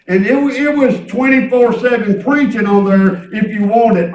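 loud, driving sermon delivery; fervent preaching. Real, not acted. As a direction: preach it out with punched phrase endings. Preaches with full voice.

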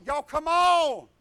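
loud, slow taunting call to the three attackers; y'all come on, hollered out; shouting a challenge. Retold or imitated. Fighter daring three men to come at him, loud. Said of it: "I'm like".

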